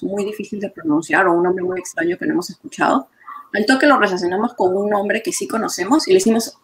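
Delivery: ordinary delivery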